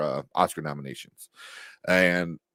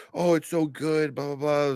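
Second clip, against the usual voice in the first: high, slow mock gushing; hammed-up gushing critic; 9 semitones higher than usual. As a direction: parody the fawning critics. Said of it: "They're just like".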